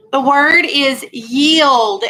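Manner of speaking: slow, deliberate word announcement. Shown as a demonstration. Teacher announcing the spelling word, slow and deliberate. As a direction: set the word down slowly. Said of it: "announcing spelling word".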